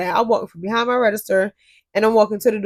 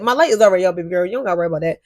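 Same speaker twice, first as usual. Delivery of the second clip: normal talking voice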